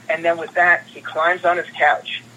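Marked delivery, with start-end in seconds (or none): none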